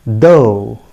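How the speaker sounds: "though" rhyming with go; correct pronunciation. Shown as a demonstration. Teacher telling learners the correct form, "though" rhyming with go. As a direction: say "though" as DOH, long o. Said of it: "it is pronounced as".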